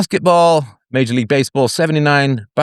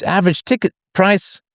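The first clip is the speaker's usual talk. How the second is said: ordinary talk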